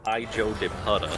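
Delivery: "Hijo de puta" with American vowels; American accent. Shown as a demonstration. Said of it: "That is an American way of saying it".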